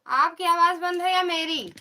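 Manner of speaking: high, loud call to check if she can be heard. Real, not acted. Vlogger asking sister on call whose sound dropped, loud and high. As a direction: raise your voice over a dead line.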